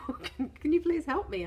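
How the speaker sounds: high, loud mock plea for help; pleading for help. Begging the council on the phone, hammed-up high plea. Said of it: "So I'm like".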